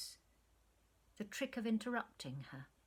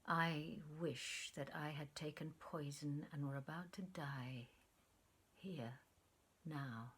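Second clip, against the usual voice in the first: hushed, lower, near a whisper; low troubled voice; 5 semitones lower, 6 dB quieter than usual. A narrator's reading. Lover on her lap wishing for death, low and troubled. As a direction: breathe it out, darkly troubled. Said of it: "in a low, troubled voice".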